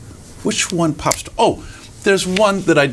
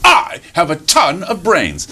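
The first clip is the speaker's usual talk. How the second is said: slow, drawn-out brag; boastful fox; 4 semitones higher, slower than usual. Acted. Fox brags to the rabbit, pompous and slow. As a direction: stretch I and TON grandly.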